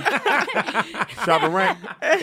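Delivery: put-on Jamaican accent. Comic riffing on friend's mom, exaggerated Jamaican accent. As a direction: draw it way out, dancehall-style Jamaican accent. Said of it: "Jamaican accent? Come on, you gotta go".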